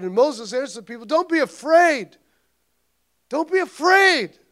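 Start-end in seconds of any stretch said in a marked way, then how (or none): 1.1-4.4 higher, louder preaching; preached reassurance. Acted. Moses telling the people not to fear, preached. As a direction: proclaim it to the people.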